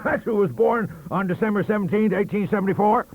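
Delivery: loud, high, laughing boast; roaring. Acted. Stacey roaring at the others, loud with laughs. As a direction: crow over the table. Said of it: "a roar from Professor Stacey".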